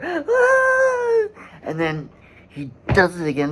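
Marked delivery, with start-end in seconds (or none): 0.0-1.3 very high sharp infant shriek; imitated baby scream. Describing the soldier's stomping, a piercing baby shriek. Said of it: "and then you hear".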